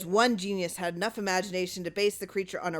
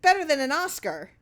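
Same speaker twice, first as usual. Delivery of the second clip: high, breathless phone call; bursting with excitement on the phone; excited; 10 semitones higher, 5 dB louder than usual. A narrator's reading.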